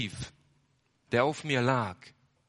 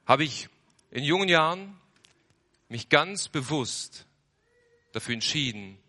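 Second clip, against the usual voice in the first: usual speaking voice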